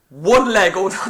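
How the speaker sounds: loud indignant opening of a retold rant; retold angry outburst. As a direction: replay your outburst, loudly. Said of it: "I, I lost it".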